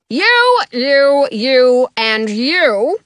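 loud royal command, pointing at each; single each one out loudly; commanding king. A narrator's reading.

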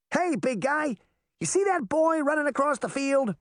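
put-on high boyish call; high-pitched, egging-on fairy boy; calling to giant. A narrator's reading. Puck calls up to the giant.

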